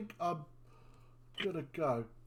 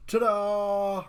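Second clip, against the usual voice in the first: excited ta-da; 7 semitones higher, 6 dB louder than usual. Acted. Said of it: "And Bert goes".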